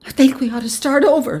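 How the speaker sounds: high, tense plea; strained restraint. A narrator's reading. Wife pleads to start over, strained restraint. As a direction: try and fail to keep it quiet. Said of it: "said as quietly as she could".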